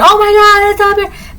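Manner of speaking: high shrill-up panic, oh my God; mock panicked shriek. Hiker spots the bear and panics, mock shriek. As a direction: squeal it like a frightened hiker. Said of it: "hooting and hollering about the bear".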